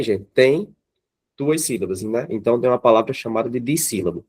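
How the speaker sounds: ordinary talk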